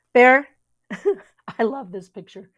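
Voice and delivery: plain reading voice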